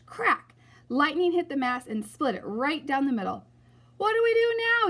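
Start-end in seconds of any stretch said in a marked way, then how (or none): 4.0-4.9 high, loud panicked yell. A narrator's reading. Pirate yelling in panic as the mast splits, high and loud. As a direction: yell it in panic over the wind. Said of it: "yelled one of the pirates".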